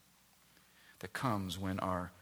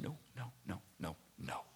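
soft refusal; 13 dB quieter than usual. Acted. Moses refusing God.

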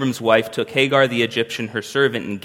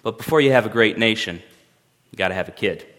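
ordinary talk

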